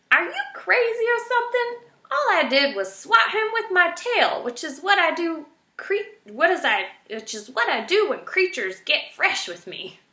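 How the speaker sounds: high, loud huffy rant; indignant old porcupine. A narrator's reading. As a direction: scoff at her nonsense. Erith indignant.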